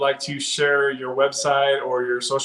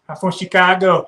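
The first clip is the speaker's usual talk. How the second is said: hammed-up Chicago accent, higher and louder; fake Chicago accent; 5 semitones higher, 7 dB louder than usual. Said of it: "do a fake accent for you".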